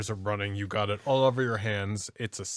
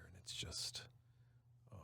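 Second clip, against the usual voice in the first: whispered; 3 semitones lower, 20 dB quieter than usual. Real, not acted. Savoring ice cream, breathy whisper.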